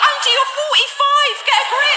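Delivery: high, slow exasperated scold; scolding auntie. Retold or imitated. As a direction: drag it out, fed up with her. Telling auntie off.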